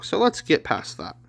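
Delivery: usual speaking voice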